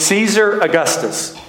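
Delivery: supply the name as a correcting grandma would; retold grandma correcting. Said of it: "She goes".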